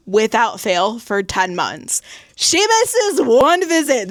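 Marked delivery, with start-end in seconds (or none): 2.4-4.1 much higher, slow and stunned; can you believe it, drawn out high; incredulous. Real, not acted. Shocked at plot twist.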